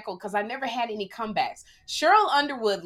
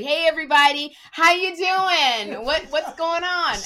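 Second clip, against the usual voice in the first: high, loud and drawn-out cheery hello; mock upbeat club-host greeting, higher and slow; 8 semitones higher, 7 dB louder than usual. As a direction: play the chipper comic working a room that isn't yours. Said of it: "that's how I talked".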